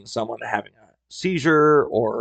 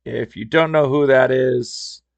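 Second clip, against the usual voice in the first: plain reading voice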